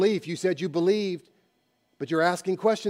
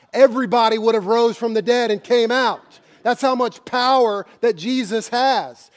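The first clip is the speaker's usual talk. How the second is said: usual speaking voice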